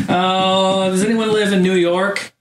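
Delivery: whining. Retold or imitated. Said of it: "I'm whining. I'm crying".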